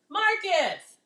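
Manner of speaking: high call across the house. Real, not acted. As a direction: sing out his name. Calling out to her son.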